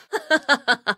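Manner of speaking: brief scornful elf laugh; short mocking laugh. Elf warden laughing at a foe who expected mercy. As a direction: laugh off the enemy's hope. Taunting foe after attack.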